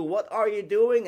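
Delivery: calling out loud and high over engine noise; shouting up from the plane. Retold or imitated. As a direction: yell the question down to the farmer. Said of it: "almost literally shouting to you".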